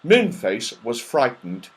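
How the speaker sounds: plain reading voice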